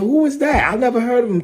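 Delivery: high-pitched mock bafflement; imitated dismissive puzzlement. Pastor voicing rappers who never heard of rival, mock puzzled. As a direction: quote them high, as if clueless.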